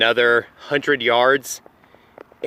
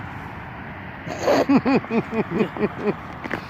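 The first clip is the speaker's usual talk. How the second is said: breathy laugh; 8 semitones higher, 5 dB quieter than usual. Real, not acted. Signing off the Halloween video.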